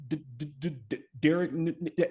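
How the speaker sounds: put-on quiet, halting repeats on "D-" and "N-" as the boy stutters; reenacted stutter. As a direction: catch on "D-" again and again before "Derek". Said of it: "as I started reading the announcements".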